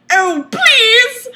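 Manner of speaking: loud, high mock pleading; overdone passionate plea; hammed-up climax cry. A narrator's reading. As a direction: camp it up, begging.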